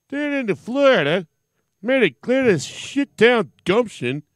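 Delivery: mock outrage. Host ranting at stand-in for ridiculing him, hammed-up outrage. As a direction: rant like an insulted big shot.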